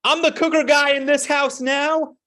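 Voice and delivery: high, loud mock proclamation to the whole house; loud declaration. Father imitating his son announcing he cooked, loud declaration. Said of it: "he declares loudly to the house".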